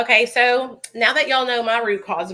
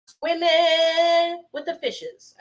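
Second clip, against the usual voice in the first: sing-song fishes; 7 semitones higher, much slower than usual. Pretend. Dreamy summer pool daydream.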